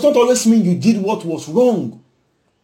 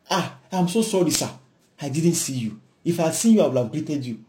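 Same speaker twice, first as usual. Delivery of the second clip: softer, lower, gentle apology; soft sincere apology; 4 semitones lower, 6 dB quieter than usual. Retold or imitated. Student to a classmate, gently apologizing in a soft voice. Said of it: "What do you say?".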